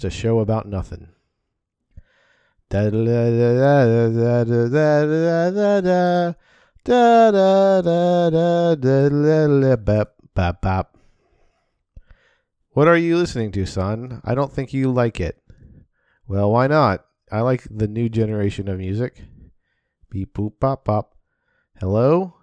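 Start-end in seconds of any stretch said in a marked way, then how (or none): 2.7-11.0 loud nonsense sound effects; loud vocal beatbox. Acted. Blasting tape of noises. 20.1-21.1 beep boop noises. Acted. Tape of silly sounds.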